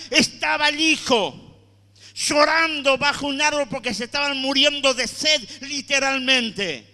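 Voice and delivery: loud, impassioned sermon cry; preaching at full force. Acted. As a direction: preach it hard to the congregation. (predicación a todo pulmón)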